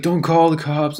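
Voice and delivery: put-on slurring drunk guy. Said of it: "his words were a little slurred".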